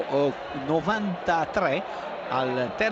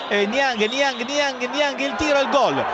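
building goal call; 5 semitones higher than usual. Real, not acted.

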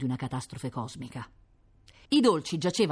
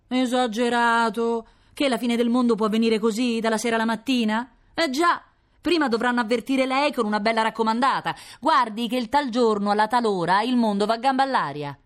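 scoffing neighbour; 8 semitones higher, 6 dB louder than usual. Acted. Neighbour mocking the alarmist.

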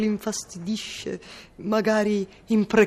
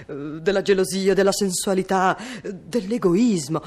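ordinary talk, nothing marked

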